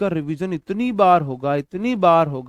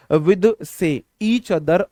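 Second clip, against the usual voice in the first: slow dictation read; 5 dB louder, much slower than usual. Shown as a demonstration. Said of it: "teacher reads the example slowly".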